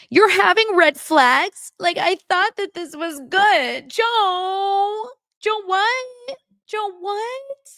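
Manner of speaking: mock hysterical fiancée, high and drawn out; Madison. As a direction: squeal it in exaggerated disbelief. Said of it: "without her freaking out".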